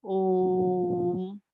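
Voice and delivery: ओम held for three beats in a lightly chanted tone; drawn-out Om. Shown as a demonstration. Teacher repeating the pluta Om for the class, slow and held. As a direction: stretch the vowel of ओम over three beats. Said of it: "तीन time के उच्चारण के बराबर से आपको समय लेना है".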